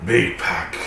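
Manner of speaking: gruff wolf speech. Acted. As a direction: answer like a blunt wolf. DM voicing wolf.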